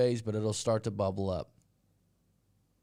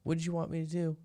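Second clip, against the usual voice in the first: higher, pleading henpecked husband; meek husband; 7 semitones higher, faster than usual. Retold or imitated. Husband answering his scolding wife, higher and pleading. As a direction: whine back helplessly like a cowed husband. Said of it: "he'd be like".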